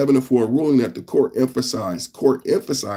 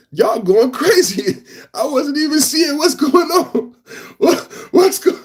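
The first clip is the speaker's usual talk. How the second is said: high, squealing through laughter; real laughing fit; 3 dB louder than usual. Real, not acted. Streamer to chat going crazy, laughing helplessly. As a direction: voice shoots up as laughter takes over.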